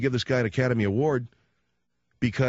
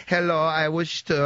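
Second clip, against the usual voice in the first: slow, slightly raised mock Italian accent; Meryl Streep's Italian accent; 4 semitones higher than usual. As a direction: put on a slow Italian accent like a movie heroine. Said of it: "using that Italian accent from Bridges of Madison".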